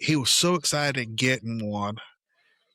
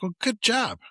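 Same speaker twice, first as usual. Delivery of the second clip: high, lightly sarcastic well done; dad teasing son with faint praise; mock dry praise; 11 semitones higher than usual. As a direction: toss off a teasing good job. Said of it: "I'm like".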